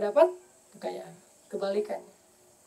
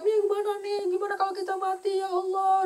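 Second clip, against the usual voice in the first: high, far, panicky wail; panicked wife; 9 semitones higher than usual. Retold or imitated. Wife panicking in the storm, high frightened cry. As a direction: play the frightened wife screaming on the ship. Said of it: "teriak-teriak".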